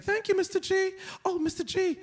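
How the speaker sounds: high-pitched imitation of a little kid; second-grader voice. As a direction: pipe up like a young pupil. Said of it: "his name is Ethan".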